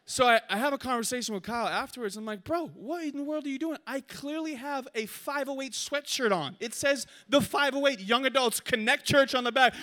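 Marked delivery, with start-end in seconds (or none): 2.4-9.7 high-pitched indignant scolding; acted exasperation. Shot player scolding teammate who missed his sweatshirt, acted exasperation. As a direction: demand an explanation in incredulous high voice.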